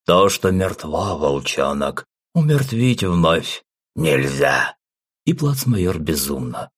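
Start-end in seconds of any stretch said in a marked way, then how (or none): 0.1-4.8 slow lifeless voice rising to a shout; dead voice. Acted. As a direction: drag it out, then shout the end. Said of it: "таким же мёртвым голосом".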